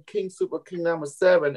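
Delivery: normal talking voice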